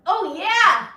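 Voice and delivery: high, stretched whoop of success; delighted, rising then swooping down; triumphant cheer. Real, not acted. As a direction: cheer yourself on mid-trick. Handstand works, she celebrates.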